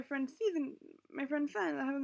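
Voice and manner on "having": usual speaking voice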